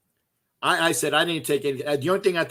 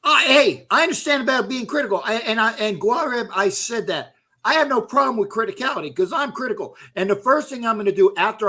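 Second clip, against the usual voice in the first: heated reply; 7 semitones higher, 3 dB louder than usual. Real, not acted. Addressing critic Guareb heatedly.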